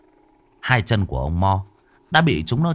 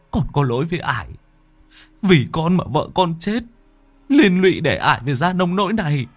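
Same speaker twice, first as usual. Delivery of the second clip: put-on higher, pained young man's voice; grieving; 9 semitones higher than usual. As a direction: speak in anguish, rising fast. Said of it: "Chàng Lưu đau xót". (giọng đau xót)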